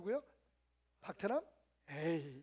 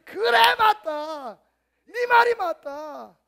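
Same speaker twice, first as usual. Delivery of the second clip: higher, louder, slowing on the last line; booming agreement; 7 semitones higher, 15 dB louder, much slower than usual. Retold or imitated. God granting the pastor's plea, booming. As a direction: thunder the yes with delight.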